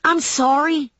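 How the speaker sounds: high-pitched yelled apology, held back; understated narrated yell; mild yell. A narrator's reading. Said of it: "I yelled at her".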